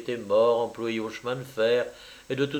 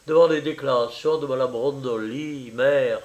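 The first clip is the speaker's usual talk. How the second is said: ordinary delivery, nothing marked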